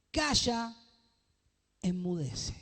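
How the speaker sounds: a firm rebuke, slow and weighty; stern command. Acted. Preacher reading Jesus rebuking the sea, firm command. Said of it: "y dijo al mar".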